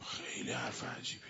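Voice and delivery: hushed whisper, almost no voice; a real whispered aside. Real, not acted. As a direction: lean in and whisper the words. Aside about a strange saying.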